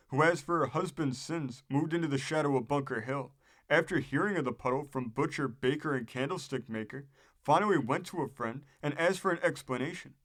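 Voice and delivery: usual speaking voice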